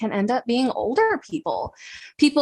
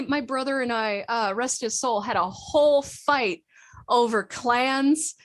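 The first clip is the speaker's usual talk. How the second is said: ordinary talk, nothing marked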